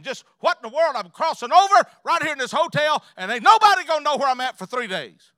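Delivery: high, loud, worked-up preacher; loud revival-style storytelling, pitched high; full-force preaching. Acted. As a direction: build a comic panic at full voice. Preaches a comic panic story.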